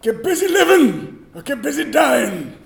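high, drawn-out movie-line impression. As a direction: stretch it out slow and high, quoting the film. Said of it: "Say something that he says".

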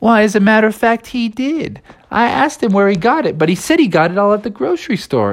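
high-pitched troll voice; chatty troll. Acted. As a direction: answer as a helpful storybook troll. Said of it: "he's like".

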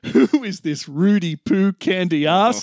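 wrestler voice. Acted. Chef's line, wrestler catchphrase.

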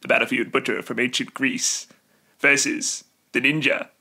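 dramatic show narrator impression, pausing between phrases; imitated documentary narrator announcing two warriors; David Wenham. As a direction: intone it like a TV fight-show intro, with weighty pauses. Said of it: "try to do the David Wenham voice".

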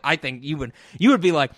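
usual speaking voice

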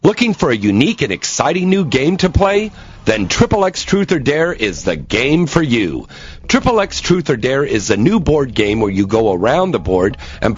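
loud, punchy ad pitch; hard sell ad. Acted. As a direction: sell it hard like a radio spot. Hard-sell radio ad read.

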